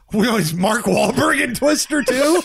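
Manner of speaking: high laughing voice. Pretend. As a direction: laugh through the words.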